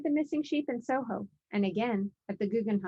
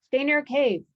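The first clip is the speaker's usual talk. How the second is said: raised, louder warning; warning call; 5 semitones higher, 7 dB louder than usual. A narrator's reading. Cyclops warning his sheep, louder and higher. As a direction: urge the flock to stay close. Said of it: "he warned his flock".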